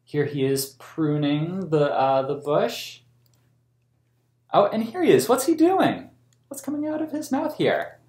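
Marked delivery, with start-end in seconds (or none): 4.5-8.0 excited story-time wonder; delighted wonder. Real, not acted. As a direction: ask with big wide-eyed delight. Delighted at picture for kids.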